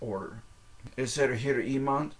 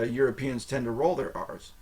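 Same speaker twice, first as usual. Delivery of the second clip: usual speaking voice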